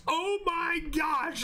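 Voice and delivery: high, drawn-out acted scream; hammed-up horrified wail, stretched slow; mock scream. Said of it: "he just screams".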